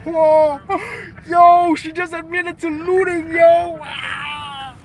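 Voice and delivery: very high, hammed-up astonishment; imagined gleeful shock. Voicing what the interviewer thinks of the looting admission, high mock shock. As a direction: squeal it in mock disbelief. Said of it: "This is what he's thinking".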